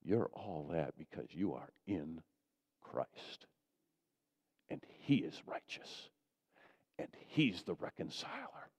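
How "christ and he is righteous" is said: whispered, near silent; reverent whisper. Real, not acted. Pastor telling the church Christ is righteous, whispered. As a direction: breathe it out in awe.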